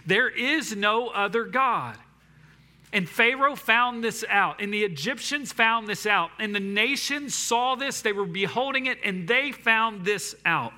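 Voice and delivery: rising impassioned sermon; preaching. Real, not acted. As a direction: preach it with fire. Preaching climax.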